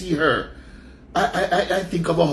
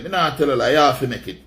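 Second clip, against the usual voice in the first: ordinary delivery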